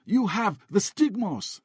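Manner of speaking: high, slow yelled accusation; man yelling at the priest in accented English. A narrator's reading. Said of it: "the man yelled".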